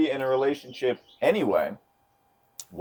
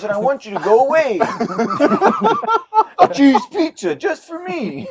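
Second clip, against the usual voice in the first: put-on bratty kid voice; 8 dB louder than usual. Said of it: "He's like".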